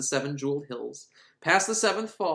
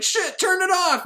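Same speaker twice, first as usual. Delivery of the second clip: panicked; 5 dB louder than usual. Retold or imitated. Panicked order as king looks back.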